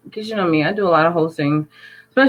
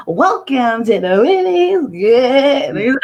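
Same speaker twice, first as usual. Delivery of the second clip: mock host welcome; 7 semitones higher, 5 dB louder, much slower than usual. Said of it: "you coach your host".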